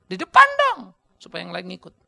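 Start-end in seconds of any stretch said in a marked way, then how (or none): 0.0-0.8 high loud insistent call; loud urging. Real, not acted. Preacher urging fathers to lead from the front, loud. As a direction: push them to the front.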